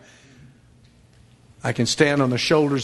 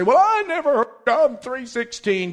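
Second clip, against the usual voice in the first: whiny excuse; 10 semitones higher than usual. Acted. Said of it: "bow before God... and say".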